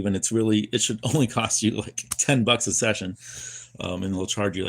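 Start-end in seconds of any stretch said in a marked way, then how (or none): none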